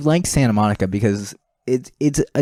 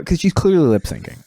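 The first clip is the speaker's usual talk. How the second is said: ordinary delivery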